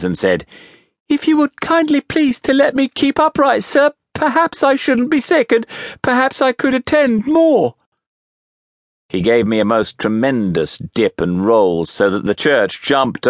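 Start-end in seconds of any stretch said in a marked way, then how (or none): 1.1-7.8 put-on very high, polite child's plea; frightened pleading. A narrator's reading. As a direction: beg courteously in a giddy little-boy squeal. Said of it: "I was dreadfully frightened and so giddy".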